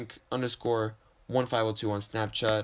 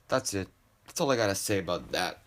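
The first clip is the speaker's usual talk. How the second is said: ordinary talk, nothing marked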